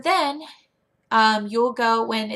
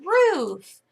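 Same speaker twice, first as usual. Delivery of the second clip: high, loud, swooping vocabulary word; animated teacher enunciation; modeling a word; 8 semitones higher, 5 dB louder than usual. Shown as a demonstration. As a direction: stretch it playfully for a young pupil.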